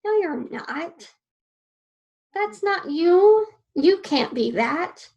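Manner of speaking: a mildly mocking inner critic, rising on the middle line. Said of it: "my brain automatically went".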